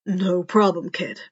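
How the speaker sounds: low gruff reply; a hint of a gruff mentor ruffling hair. A narrator's reading. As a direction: brush it off gruffly. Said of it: "replied gruffly".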